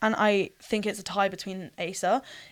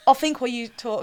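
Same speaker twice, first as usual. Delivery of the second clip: normal talking voice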